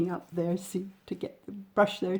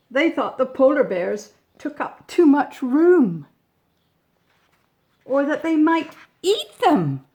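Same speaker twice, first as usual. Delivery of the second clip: louder and higher, dramatised worry; hammed-up nervousness; mock alarm; 6 semitones higher, 6 dB louder than usual. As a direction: play up the grown-ups' fright. Grown-ups' fears about bears.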